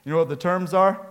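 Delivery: ordinary delivery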